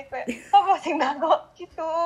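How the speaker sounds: normal talking voice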